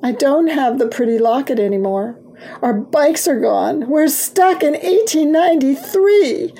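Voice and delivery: distress building to a high wail; complain, rising toward tears; near sobbing. A narrator's reading. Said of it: "Virginia began to sob".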